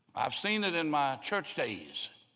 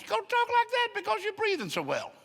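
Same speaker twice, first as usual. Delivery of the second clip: much higher, louder mock voice; high squeaky put-on voice; 4 dB louder than usual. Preacher mimics the voice of someone breathing freely, high and squeaky. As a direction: squeak it out as if breathing too well. Imitating freed-up voice.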